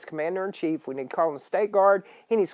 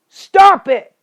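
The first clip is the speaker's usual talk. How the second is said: loud, much higher yell; furious shout; 9 semitones higher, 11 dB louder than usual. Real, not acted. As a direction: yell it to stop them cold. Ranting at patriots.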